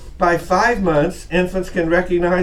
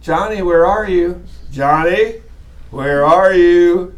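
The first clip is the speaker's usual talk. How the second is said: louder calls, stretched at the end; calling a child; 4 dB louder, slower than usual. Shown as a demonstration. Parent calling Johnny by name, calling out. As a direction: call out for a missing kid, drawing out the last words. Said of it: "You just call their name".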